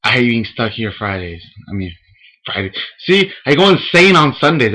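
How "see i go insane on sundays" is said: higher, louder burst of frustration; exaggerated Sunday despair; excited rant. Real, not acted.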